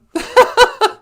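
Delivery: shrieking giggle after the bit; high burst of laughter. Real, not acted. Laughing at own impression.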